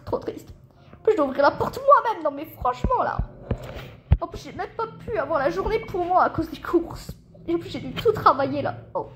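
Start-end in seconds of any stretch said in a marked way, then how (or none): none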